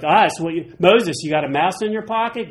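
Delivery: loud, incredulous sass; mocking disbelief. Pastor scoffing at Moses's "us", loud mock disbelief. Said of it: "And I'm like".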